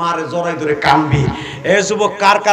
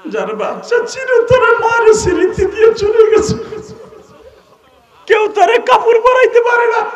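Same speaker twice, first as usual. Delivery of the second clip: mock shout; 5 dB louder than usual. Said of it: "সবাই কান দেয়ার কয়".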